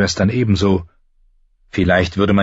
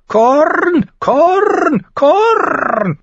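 very high, loud repeated bird cry; screeching raven; 4 dB louder, much slower than usual. A narrator's reading. Mormont's raven begging for corn, screeching. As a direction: squawk each word like a demanding raven. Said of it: "kreischte der Vogel".

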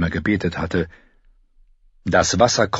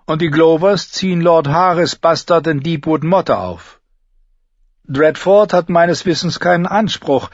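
a touch higher, measured counsel; lightly voiced maester; 8 semitones higher, 4 dB louder than usual. A narrator's reading. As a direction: explain the lords' claims like a careful advisor. Maester reports on Hornwood succession.

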